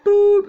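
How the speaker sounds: loud sudden "boop" outburst; frustrated yelp. Real, not acted. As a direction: blurt a loud frustrated noise. Own car crashes.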